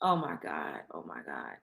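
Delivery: real breaking sorrow, very soft; near tears. Real, not acted. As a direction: whisper it, on the verge of tears. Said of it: "I feel like I'm gonna cry".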